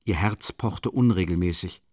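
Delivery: ordinary delivery, nothing marked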